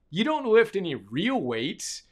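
normal talking voice

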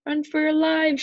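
high, loud storybook alarm; panicked shout. A narrator's reading. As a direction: send everyone running. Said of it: "shouted Neil".

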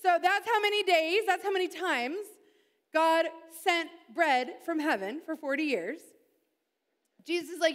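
normal talking voice

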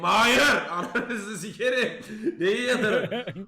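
loud laughing protest, shouting no; panicked shout. Retold or imitated. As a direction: yell it back at the doctor, half laughing. Panicked refusal, screaming at doctor. (doktora bağırarak gülerek itiraz)

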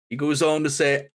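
normal talking voice

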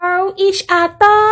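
high "each other" in sudden surprise; bright high rise of recognition; surprised realisation. Retold or imitated. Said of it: "พูดไม่ชัดนะเนี่ย". (each other เสียงสูงแบบเพิ่งเข้าใจ)

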